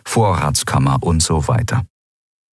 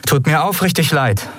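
insincere apology; 9 semitones higher than usual. Acted. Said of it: "log sie".

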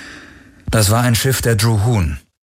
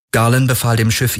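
ordinary delivery

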